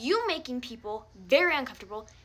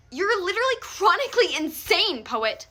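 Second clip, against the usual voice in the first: higher, louder burst of disbelief; outraged outburst; 7 semitones higher, 5 dB louder than usual. Real, not acted. Calling Poet insane, a loud outraged burst. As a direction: blurt it out in outrage.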